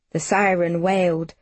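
usual speaking voice

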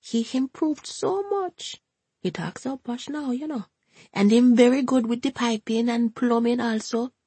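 high, Jamaican-lilting grandmother; a high, fussy Jamaican gran chatting; Hortense's Jamaican voice. A narrator's reading. Hortense speaks, narrator voicing.

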